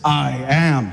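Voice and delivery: low, assured declaration; man swagger. Acted. Jesus answering those come to arrest him, low swagger. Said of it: "He had the man swagger too".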